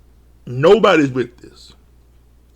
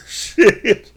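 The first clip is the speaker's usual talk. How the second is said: laughing; 4 semitones higher than usual. Real, not acted.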